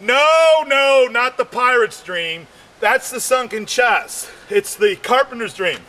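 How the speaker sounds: ordinary talk, nothing marked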